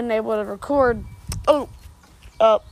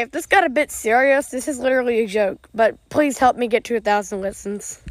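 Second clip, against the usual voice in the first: usual speaking voice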